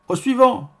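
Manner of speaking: high, loud shopkeeper's call; yelping. A narrator's reading. Baker calling the next customer, yelping. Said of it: "glapit la boulangère".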